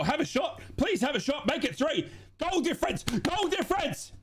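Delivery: high, begging the player to shoot; desperate pleading. Real, not acted. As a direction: plead at the screen, on edge. Pleading for a shot in stoppage time.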